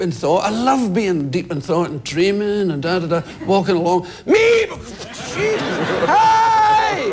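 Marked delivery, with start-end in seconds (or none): 4.3-4.7 high, loud imitated horn beep; imitation of a pumped horn; car horn honk. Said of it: "people who pump their horns". 4.7-7.1 high-pitched startled yelp; hammed-up shock at a horn; acted fright. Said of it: "often give me a fright".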